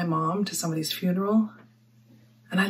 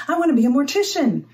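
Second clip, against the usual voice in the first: higher, louder, exaggerated quote; mock childhood dream; 7 semitones higher, 5 dB louder than usual. Mortician mimicking a little girl's unlikely dream, mock-eager. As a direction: pitch it up as an eager, unlikely wish.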